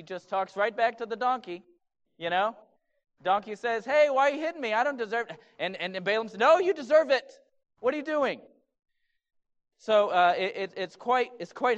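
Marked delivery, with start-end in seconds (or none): 3.8-5.4 high, loud complaint as the donkey; protesting donkey. Acted. Donkey objects to Balaam hitting it, indignant. 6.4-8.4 loud, high-pitched acted scolding; mock angry retort. Balaam talks right back to his donkey, mock angry.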